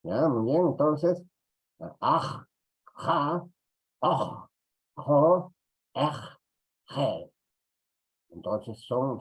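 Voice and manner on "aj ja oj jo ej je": "aj, ja, oj, jo, ej, je" each sound set apart clearly; teacher modelling Quechua sounds; sound demo. Shown as a demonstration. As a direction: model the sounds one by one for practice. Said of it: "eso es lo que vamos a practicar".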